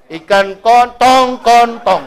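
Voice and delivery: kontong said slow and drawn out for tongkol; mock a child slowly mixing up kontong; wrong word kontong. Preacher mocking a child's mix-up: slow, drawn-out kontong. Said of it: "Salah sebut".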